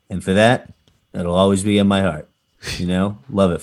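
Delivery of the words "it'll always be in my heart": ordinary talk, nothing marked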